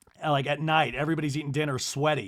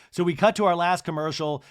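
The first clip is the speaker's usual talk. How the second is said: ordinary delivery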